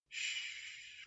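soft breathy shh, no voice; whispered shush. Acted. Laura soothes the crying Amin, whispered shush. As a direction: hush him gently in a whisper. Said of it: "Laura whispered".

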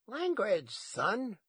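high-pitched granny reprimand; high, prim telling-off; scolding grandma. A narrator's reading.

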